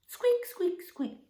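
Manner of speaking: very high, tiny squeaks; mouse squeak. Pretend. Practising the story cue with children, tiny high squeaks. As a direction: give your best little mouse. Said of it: "best mouse with that squeak".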